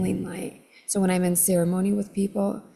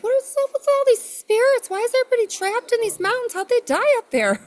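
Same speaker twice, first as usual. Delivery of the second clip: much higher, louder, bewildered quote; high incredulous squeal; 5 dB louder, faster than usual. Retold or imitated. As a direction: squeak it out in disbelief. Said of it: "I was like".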